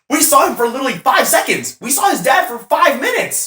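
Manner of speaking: high, incredulous retold protest; excited disbelief. Telling friends about crying, high incredulous protest. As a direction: squeak out the disbelief. Retelling own outburst at movie.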